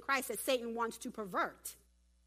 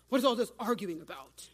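changed voice for Jesus; 5 dB louder than usual. Acted. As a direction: drop into a firm Jesus voice. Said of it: "let me change my voice".

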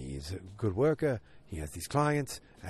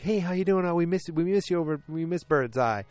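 chummy Pete greeting; 8 semitones higher, 5 dB louder than usual. Retold or imitated. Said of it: "Pete's like".

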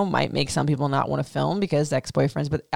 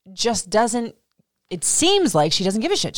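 normal talking voice

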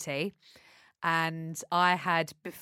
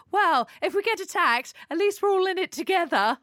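high, louder bright quote of a friend; mock cheery friend; 10 semitones higher, 3 dB louder than usual. Friend answering her worry in a high chirpy voice.

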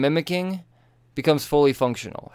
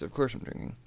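ordinary delivery

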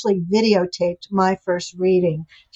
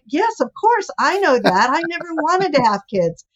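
high, amused, laughing it off; laughing reply; 7 semitones higher than usual. Retold or imitated. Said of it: "And I start laughing".

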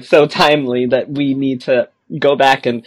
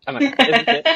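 higher, with a laugh; laughing question; 10 semitones higher, much faster than usual. Retold or imitated. Player laughing at her own nervousness, higher. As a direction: crack up on the last one.

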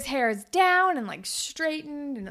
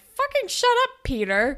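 high, louder outburst dropping on the name; mock exasperation; 11 semitones higher, 4 dB louder than usual. Viewer talks back to Peter, mock exasperation. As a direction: snap at him in a high voice.

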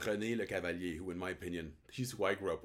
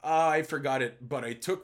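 mock sheepish excuse; 4 semitones higher, 8 dB louder than usual. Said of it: "They're like".

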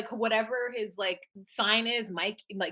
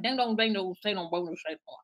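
mock gibberish, trailing off lower and softer; imitated garbled signing; 5 semitones lower, 4 dB quieter than usual. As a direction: babble nonsense syllables as a mock accent. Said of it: "what they're saying actually sounds like".

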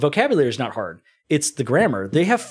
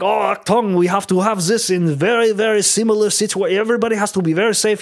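higher, hammed-up German accent; mock German accent, quick and pitched up; 6 semitones higher than usual. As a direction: play a fussy German safety officer in a thick German accent. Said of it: "Dude, the accents".

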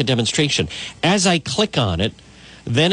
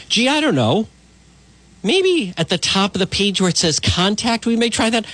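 sarcastic; 7 semitones higher than usual. Acted. Sarcastic retort to email question.

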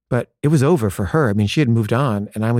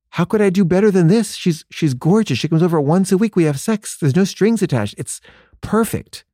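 insistent defense; 7 semitones higher than usual. Retold or imitated. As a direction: argue it's perfect, voice climbing. Defending his arrangement to friend.

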